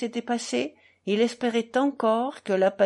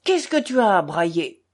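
gruff innkeeper; 5 dB louder than usual. A narrator's reading. Innkeeper scolds the shouting Pole.

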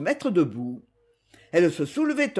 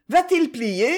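high-pitched questioning call; 7 semitones higher, 4 dB louder than usual. A narrator's reading. Lucette asks Rick about the tree, calling out. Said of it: "cria-t-elle à Rick".

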